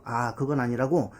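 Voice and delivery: ordinary talk, nothing marked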